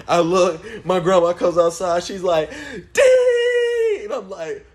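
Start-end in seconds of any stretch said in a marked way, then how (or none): 2.9-4.0 very high loud shrieked name; grandma's yell. Retold or imitated. Grandma catching the boys, shrieked name. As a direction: scream the name like an angry grandma. Grandma comes outside yelling.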